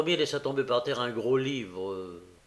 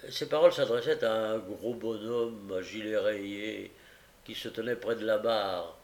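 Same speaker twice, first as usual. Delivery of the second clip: usual speaking voice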